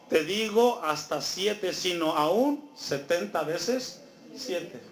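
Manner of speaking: slow, deliberate verdict; slow emphatic answer. Acted. Jesus slowly answering Peter, deliberate. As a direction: spell out each word of the number.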